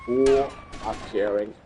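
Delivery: low, slow nonchalant shrug; cool indifference, slow and low; unbothered. Acted. Acting unbothered by chasing cops.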